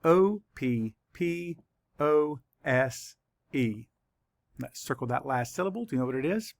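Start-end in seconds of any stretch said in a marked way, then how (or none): 0.0-3.9 very slow, spaced-out letters; letter by letter, leaving time to write; spelling for dictation. Shown as a demonstration. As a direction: spell slowly, pausing after each letter. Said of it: "let's write it".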